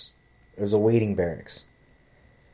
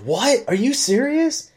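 high, louder mock-shocked outburst; retold squeal of astonishment; incredulous girlfriend; 5 dB louder, slower than usual. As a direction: imitate her disbelief, pitch leaping right up.

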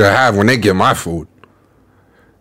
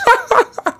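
quick high-pitched burst of laughter; short high laugh. Real, not acted. Host amused by the woman's drama, laughing. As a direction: break into a brief high laugh.

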